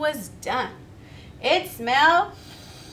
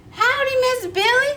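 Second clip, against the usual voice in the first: much higher, louder friendly cowboy greeting; cowboy howdy; 11 semitones higher, 6 dB louder than usual. Acted. Tex greeting Miss Billy, high and loud. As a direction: call out a big hearty howdy. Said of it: "said Tex".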